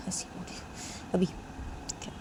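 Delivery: very soft, voiceless murmur; almost whisper it, slowly; acted faint objection; hushed protest. Acted quarrel, taken aback.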